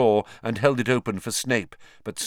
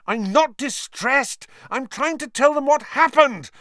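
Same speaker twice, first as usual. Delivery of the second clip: high, loud frustrated protest; furious; 6 dB louder than usual. Acted. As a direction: insist hotly, exasperated. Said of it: "Harry said furiously".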